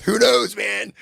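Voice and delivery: high, loud and thrown out; loud exclamation. Real, not acted. Rhetorical outburst about hidden rulers.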